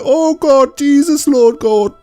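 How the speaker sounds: very high, hammed-up wail; mock panic. Recapper as the next victims brought back, mock panicked wail. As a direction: imitate distress way up high.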